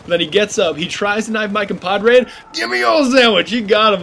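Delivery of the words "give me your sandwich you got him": high, loud cheer; excited shout. Real, not acted. Gamer cheering his teammate's kill, loud and high.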